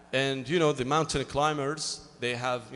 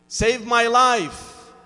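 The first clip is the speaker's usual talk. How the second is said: begging; 6 semitones higher, 8 dB louder, much slower than usual. Acted. Climber begging God.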